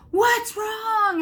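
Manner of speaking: high, loud alarmed cry; little sister running in to her crying sister; alarmed call. Retold or imitated. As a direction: burst in calling out in worry.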